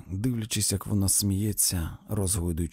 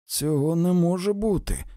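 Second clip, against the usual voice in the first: raised, higher voice of disbelief; almost exclaiming; 8 semitones higher, 5 dB louder than usual. A narrator's reading. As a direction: protest just short of a shout. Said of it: "ледве не вигукнув він". (майже вигукуючи)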